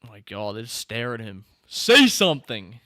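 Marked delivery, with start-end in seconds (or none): 1.7-2.8 slow, very loud demand; urgent shout. Real, not acted. Viewer urging silent film characters to speak, urgent shout. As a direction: shout it out, stretching the words.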